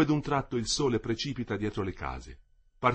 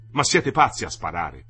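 raised, lower-pitched cry of protest; shouted protest; 4 semitones lower, 7 dB louder than usual. A narrator's reading. The German shouts at soldiers pointing muskets, shouted protest. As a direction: shout it in disbelief at armed men. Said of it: "aveva gridato il tedesco".

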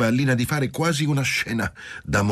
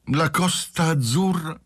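much higher, slow, lilting mock horror; 10 semitones higher, slower than usual. A narrator's reading. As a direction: sing out your disgust cheerfully and impetuously. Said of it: "esclamava intanto Lina ... allegramente, impetuosamente, con voce cantante".

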